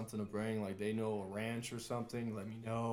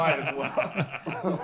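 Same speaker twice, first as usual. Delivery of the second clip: laughing; 4 semitones higher, 8 dB louder, much slower than usual. Real, not acted. Very slow laughing reply.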